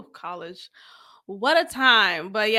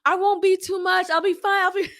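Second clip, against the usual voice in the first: eager pleading; 6 semitones higher, faster than usual. Retold or imitated. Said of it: "I responded, I was like".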